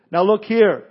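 loud, irritated retort from the stranger; heated reply. A narrator's reading. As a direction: snap back at the repeat caller. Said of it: "came the heated reply".

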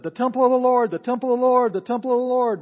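loud, high chant of "The temple of the Lord"; emphatic repetition. Retold or imitated. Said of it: "said it three times to emphasize it".

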